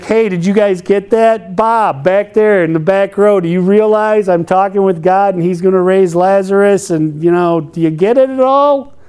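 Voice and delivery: mock condescending. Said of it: "being like".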